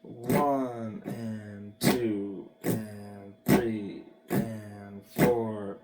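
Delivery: very slow count, each beat drawn out. Shown as a demonstration. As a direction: count it out slowly, stretching every beat. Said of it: "just do it slow".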